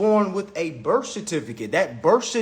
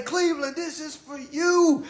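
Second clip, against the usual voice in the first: LeBron impression; 8 semitones higher, 4 dB quieter than usual. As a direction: stretch it out high, like addressing a whole city. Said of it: "Where, uh, LeBron say".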